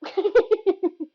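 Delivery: high burst of giggles; giggling. Real, not acted. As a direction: giggle as you start. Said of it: "gives me the giggles".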